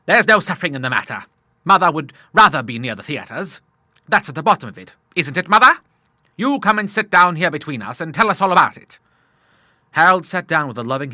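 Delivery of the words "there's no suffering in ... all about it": loud, higher bluff father; hearty Admiral. Acted. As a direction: take charge as a hearty old sailor.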